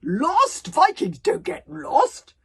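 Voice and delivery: loud, high, drawn-out disbelief; snorting scorn. Acted. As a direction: scoff at the very idea, booming. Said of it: "snorted Gobber".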